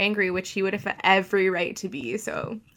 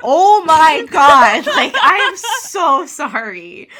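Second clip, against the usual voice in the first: high, loud laughing "oh my God, so sorry"; flustered laughing apology; embarrassed laughing apology; 8 semitones higher, 12 dB louder, slower than usual. Retold or imitated. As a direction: gush out a mortified apology through laughter. Embarrassed apology after barging in.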